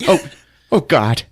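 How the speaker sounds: ordinary talk, nothing marked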